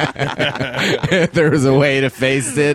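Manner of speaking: words squeezed out through laughter; slow and laughing. Real, not acted. Laughing at own joke.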